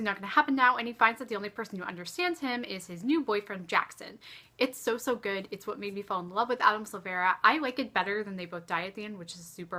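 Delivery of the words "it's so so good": normal talking voice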